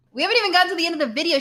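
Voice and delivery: very fast, tumbling out; rushed aside. Real, not acted. Host rushes to tell friends the video isn't over, very fast. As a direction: blurt it out in a rush.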